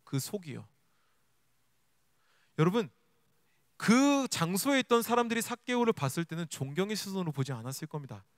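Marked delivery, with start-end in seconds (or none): none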